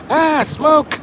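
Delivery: high-pitched pretend scream of alarm; mock alarm. Mock alarm at buildings on fire.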